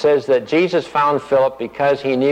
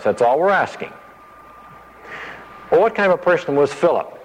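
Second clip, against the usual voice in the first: ordinary talk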